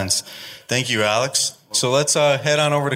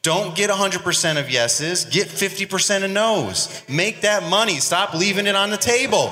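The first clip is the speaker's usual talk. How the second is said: hyped up; 7 semitones higher than usual. Real, not acted. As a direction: pump up the crowd. Hyping the crowd on pricing.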